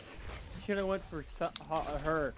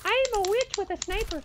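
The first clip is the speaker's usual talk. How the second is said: high cackling witch voice; 13 semitones higher than usual. Pretend. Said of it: "you sound like a witch".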